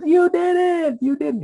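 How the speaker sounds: very high squealing cheer; excited child. Retold or imitated. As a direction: squeal it like a thrilled five-year-old. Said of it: "He's so excited".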